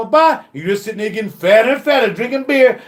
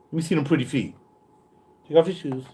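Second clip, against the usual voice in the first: smooth talk; 6 semitones lower, 9 dB quieter, faster than usual. Acted. Producer sweet-talking the girlfriend.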